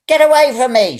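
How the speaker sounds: high, loud yell. A narrator's reading. Old woman yells at the man trying to help, high and loud. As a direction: push him off with the voice. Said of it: "she yelled".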